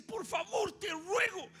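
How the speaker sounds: very high, loud, shouted plea; desperate begging. Acted. Jairus begging Jesus for his daughter, shouted plea. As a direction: scream for your daughter's life. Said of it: "y le dice".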